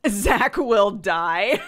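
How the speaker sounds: loud, slow, hammed-up wail; mock wail. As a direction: drag it out like a melodramatic lament. Mock despair over Zach's fate.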